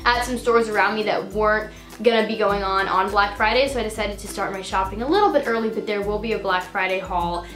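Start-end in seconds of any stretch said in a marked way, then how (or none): none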